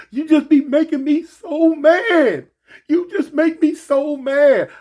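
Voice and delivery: put-on high, loud and slow sulking girlfriend; girlfriend's voice. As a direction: stretch it out high like a pouting girlfriend. Girlfriend voiced in retold fight.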